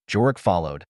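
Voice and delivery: plain reading voice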